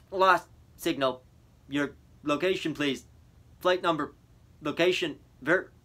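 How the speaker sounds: operator fading into static; radio operator. A narrator's reading.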